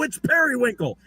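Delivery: high, petty mock lawyer protest. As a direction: squeak it like a fussy lawyer. Mocking bickering lawyers.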